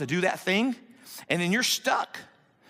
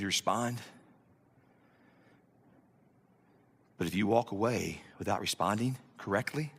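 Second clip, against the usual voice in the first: low, hushed, deliberate warning with long pauses; soft, low pulpit gravity; solemn preaching; 10 semitones lower, 6 dB quieter than usual. Real, not acted. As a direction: bring it down low and quiet, let each phrase land.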